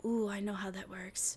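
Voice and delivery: soft unvoiced ooh; whisper. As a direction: breathe it like a secret. Imitating her own inner voice.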